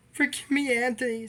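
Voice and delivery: high pleading voice; hammed-up pleading tone; mock plea. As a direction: beg forgiveness, lightly voiced. Retold plea.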